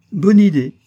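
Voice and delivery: loud, high burst of approval; exclaiming. A narrator's reading. Father hailing Ernest's idea of shells, exclaiming. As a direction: cry out at a welcome suggestion. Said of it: "m'écriai-je".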